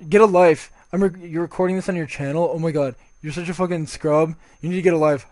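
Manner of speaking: imitating an irritated opponent; mildly exasperated insult, acted out; mock pissed off. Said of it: "He's, like, fucking pissed off at me".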